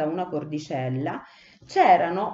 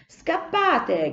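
high, urgent cry of Scappate; shouted; 9 semitones higher, 3 dB louder than usual. A narrator's reading. Cipì shouting at the three sparrows to flee, high urgent cry. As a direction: scream at them to flee. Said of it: "gridò Cipì ai tre passeri".

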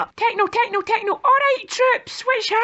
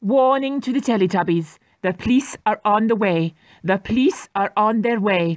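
much lower, steady official announcement; booming police loudspeaker warning; 13 semitones lower than usual. Acted. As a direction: warn them like a stern tannoy voice.

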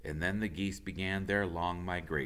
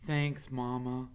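put-on a lightly higher young gosling voice; hint of a young goose; 8 semitones higher than usual. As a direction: answer like a calm young bird. Gosling thanks mother.

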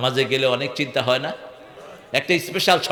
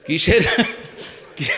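ordinary talk, nothing marked

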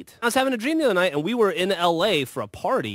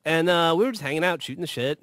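normal talking voice

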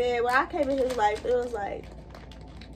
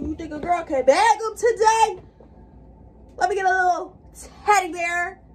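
playful flirt; 5 semitones higher, 6 dB louder than usual. Pretend.

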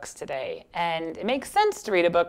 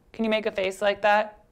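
usual speaking voice

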